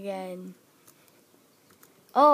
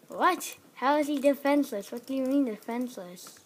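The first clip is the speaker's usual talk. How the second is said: ordinary talk, nothing marked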